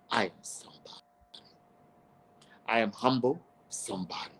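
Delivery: a hushed private affirmation; whispered. Real, not acted. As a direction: breathe it like a secret vow.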